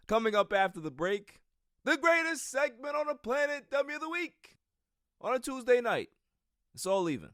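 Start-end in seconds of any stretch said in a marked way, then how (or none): 0.1-6.1 much higher, booming segment announcement; hype announcer. Acted. Host teasing the segment after the break, hyped announcer voice. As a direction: sell the segment like a game-show host. Hyping the show's next segment.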